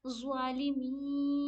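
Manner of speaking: slow, ज़ालिमीना with thick heavy ज़ा; full-mouthed ज़ा. Shown as a demonstration. Teacher repeating the word with heavy ज़ा. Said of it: "blue वाले को मुंह भर के पढ़ेंगे".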